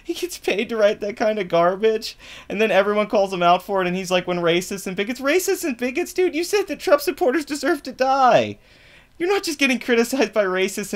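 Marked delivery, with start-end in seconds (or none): 4.3-10.9 mocking retort. Mimicking then retorting, voice high.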